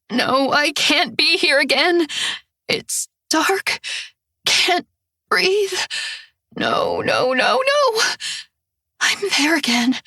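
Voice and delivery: high, gasping panic; suffocating terror; breathless panic. Acted. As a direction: fight for air between words. Can't breathe, panic in the dark.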